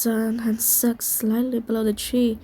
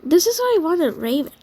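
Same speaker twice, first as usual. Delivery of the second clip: huffy, higher-pitched complaint; exasperated huff; 5 semitones higher, 4 dB louder than usual. A narrator's reading. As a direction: huff it out, put out. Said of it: "Grey Moon huffed".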